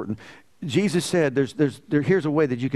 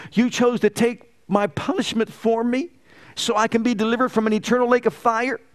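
rising, swelling prayer to Jesus; voiced prayer; 5 semitones higher than usual. Worshipper praying to Jesus, rising fervent prayer. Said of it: "Or you can say".

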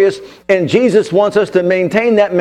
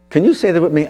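ordinary talk

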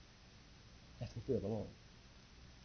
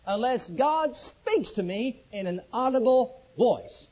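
each word slowed and pressed, louder; slow firm vow; 4 semitones higher, 5 dB louder, much slower than usual. Retold or imitated. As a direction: lay down the vow word by word, defiant. Vowing firmly to the pastor.

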